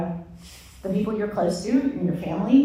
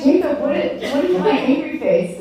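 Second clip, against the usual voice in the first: indignant question, pitched higher and louder; mock indignant; 7 semitones higher, 6 dB louder than usual. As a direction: challenge him, half-playful. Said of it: "So I'm like".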